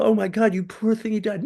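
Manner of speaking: high, pitying mock sympathy; sympathetic friend. Friend consoling a widow, high pitying voice. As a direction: coo it like a sorry friend. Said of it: "Somebody, you know".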